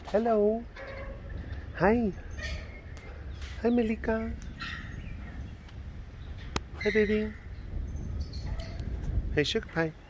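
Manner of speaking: very high, cooing greeting; pet talk. Real, not acted. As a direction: coo a sweet hello to a cat in a high voice. Greeting a cat sweetly.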